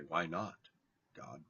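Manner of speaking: soft, low "Why not, God?" said gently; hushed plea; quiet prayer. Shown as a demonstration.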